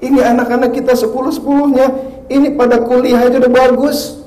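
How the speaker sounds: high, loud exasperated husband. Retold or imitated. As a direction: burst out at the wife. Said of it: "Apa kata suaminya?". (suami gemas, nada tinggi)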